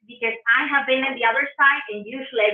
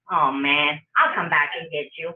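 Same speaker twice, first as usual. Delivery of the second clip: low, playful warning; mock threat; 8 semitones lower than usual. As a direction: drop low for a joking threat. Jokey threat to the audience.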